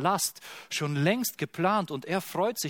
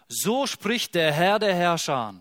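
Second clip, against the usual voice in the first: louder, slow, weighty declaration; solemn proclamation; 4 semitones higher, 6 dB louder, slower than usual. Acted. Preacher reads the prophet aloud, booming solemn proclamation. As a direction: announce the Lord's word with full weight.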